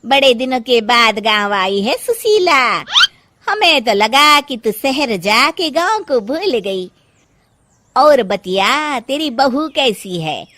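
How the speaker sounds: loud, teasing gossip voice; nosy village woman. Acted. Village woman prying at Sushila, loud and teasing. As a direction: needle her about her daughter-in-law.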